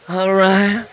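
limp, wet-noodle monotone voice; put-on feeble, defeated voice. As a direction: answer like a drooping weakling.

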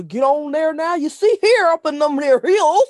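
mocking the movie's fake Southern country accent. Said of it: "All the sayings".

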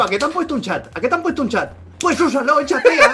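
채팅 안 쓰는 사람을 꾸짖으며 점점 높고 크게 웃음 섞어 외치기; building exasperation. Acted. Scolding buyers who won't use chat.

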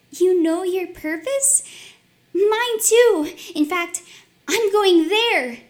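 high, loud and excited; bright childlike eagerness; eager young girl. Acted. Maria voiced, eager child.

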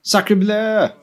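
hammed-up French accent, slow; French chef. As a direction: exclaim like a stage Frenchman. Mock French exclamations.

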